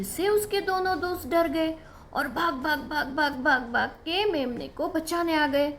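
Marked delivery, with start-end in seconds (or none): none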